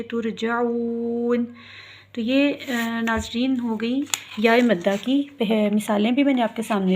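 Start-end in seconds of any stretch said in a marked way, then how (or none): 0.0-1.4 तुरजाऊन slow with the ū long; stretched recitation. Shown as a demonstration. Teacher shows lengthened तुरजाऊन. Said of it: "इसको लंबा करेंगे".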